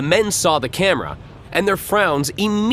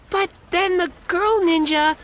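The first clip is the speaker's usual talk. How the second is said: put-on much higher eager kid voice; little boy voice. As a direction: tell it like a proud little boy.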